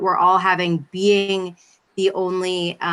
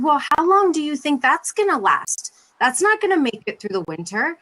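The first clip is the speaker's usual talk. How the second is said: higher, worked-up imitation of a husband's protest; imitated huffy complaint, pitched up; 8 semitones higher than usual. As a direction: voice a mock-indignant husband making his case. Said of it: "my husband was kinda huffy about it".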